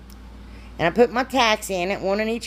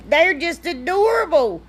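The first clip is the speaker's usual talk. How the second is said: gushing; 8 semitones higher, 3 dB louder than usual. Real, not acted. Gushing over finished crates.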